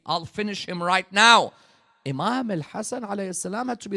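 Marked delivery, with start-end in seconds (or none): 0.1-1.5 fierce vow. Acted. Fidda vows to fight.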